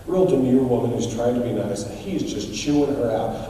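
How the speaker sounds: usual speaking voice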